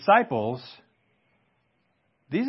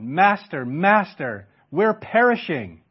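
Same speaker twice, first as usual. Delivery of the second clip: panicked plea rising high and loud; frightened cry; 6 semitones higher, 7 dB louder, slower than usual. Acted. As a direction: cry out in terror for help. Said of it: "you can hear their utter faintness of heart".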